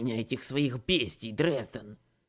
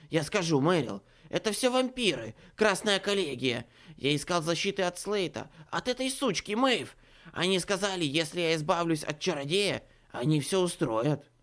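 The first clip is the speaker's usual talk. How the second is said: panicked confession; 5 semitones higher, 5 dB louder than usual. A narrator's reading.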